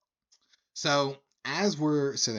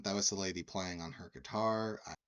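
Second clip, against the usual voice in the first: ordinary delivery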